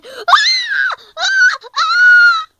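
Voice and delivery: piercing, loud creature shriek; high dragon screech. Acted. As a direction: screech out in short high bursts. Pet dragon cries out.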